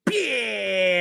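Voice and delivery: high karate yell; Van Damme kiai. Retold or imitated. Imitating Van Damme's fight cry for chat. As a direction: snap out a kiai. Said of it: "the way he was always like this".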